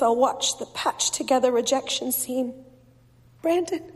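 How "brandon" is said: high, mildly tearful plea; weeping. A narrator's reading. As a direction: raise the voice as if close to tears. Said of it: "I say, weeping".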